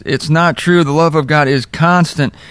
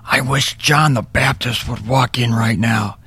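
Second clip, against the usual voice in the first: hushed whisper to his wife; 3 semitones lower, 3 dB quieter than usual. Retold or imitated. Whispering to wife Melody in church, hushed. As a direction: whisper it during the service. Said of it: "I whispered to her".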